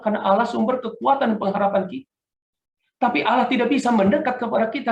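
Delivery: fervent sermon delivery; preaching. Acted. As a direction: drive the point home to the church. Preaching at full force.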